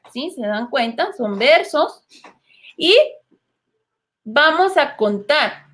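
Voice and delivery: usual speaking voice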